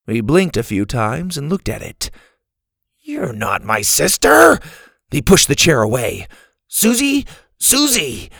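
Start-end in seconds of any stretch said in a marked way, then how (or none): 3.0-4.6 drawn-out, higher-pitched startled cry; startled protest. Acted. Boy recoils from the chair. 6.7-8.3 high-pitched searching call for a sister. Acted. Boy calling out for his sister.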